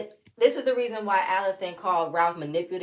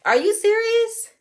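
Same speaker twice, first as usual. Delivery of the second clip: much higher, louder, slow; shocked disbelief; 9 dB louder than usual. Retold or imitated. Vlogger retelling her shock at Ralph, high slow disbelief. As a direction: stretch out the shocked question. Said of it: "I was like".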